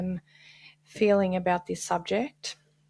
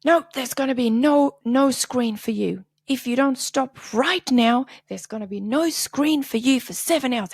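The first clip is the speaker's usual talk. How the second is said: threatening mom; 5 semitones higher, 4 dB louder than usual. Retold or imitated. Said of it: "So she's like".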